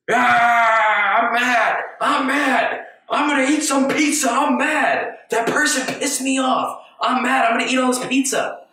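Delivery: put-on high, loud childish tantrum voice; tantrum inner kid. The inner kid throws a fit over pizza, high loud whiny tantrum. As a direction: play a cranky kid stomping about pizza. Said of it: "the inner kid just says".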